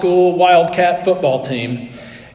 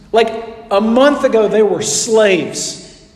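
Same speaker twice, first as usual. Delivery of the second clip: normal talking voice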